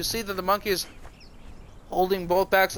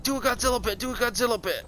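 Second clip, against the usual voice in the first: higher-pitched chanted request; eager mock pleading; 5 semitones higher than usual. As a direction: imitate fans egging someone on. People begging him for the bit.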